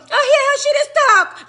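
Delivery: high, mocking sing-song; mock quote of the gossipers; mocking them. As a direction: mimic their dismissive tone. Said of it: "when I fucking cuss all you".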